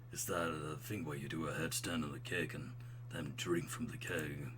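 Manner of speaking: low, soft dude voice, slowing; smooth, buttery bro. Acted. College buddy talking about party tricks, smooth low voice. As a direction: keep it deep and laid-back. Plays the character Craig.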